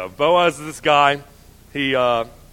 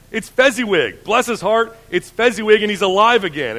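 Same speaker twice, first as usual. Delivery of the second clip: excited cry; 5 semitones higher, 5 dB louder than usual. A narrator's reading. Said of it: "Scrooge cried out in great excitement".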